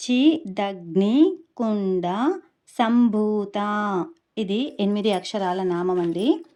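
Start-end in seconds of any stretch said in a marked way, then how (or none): 0.1-3.6 చిదగ్నికుండ సంభూత drawn out very slowly and loudly, each syllable clear; syllable-by-syllable recitation. Shown as a demonstration. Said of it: "ఇప్పుడు ఎలా పలకాలి?".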